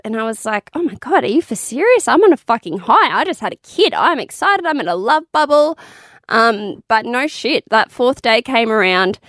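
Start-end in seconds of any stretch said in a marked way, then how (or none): 0.7-5.8 high, swooping, incredulous excitement; excited protest. Retold or imitated. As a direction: gush in disbelief, pitch leaping up. Retells her elated reply to advice.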